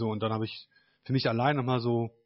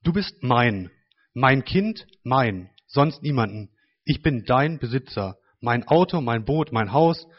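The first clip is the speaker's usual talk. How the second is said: louder, earnest prayer reading; reading a prayer aloud; 3 semitones higher, 4 dB louder, slower than usual. Acted. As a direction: read your written prayer with conviction. Reads own written prayer. (laut vorgelesenes Gebet)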